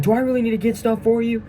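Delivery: high, loud mock drill-sergeant yell. Sergeant teasing a recruit about forgotten syrup, high loud mock yell. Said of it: "He's like".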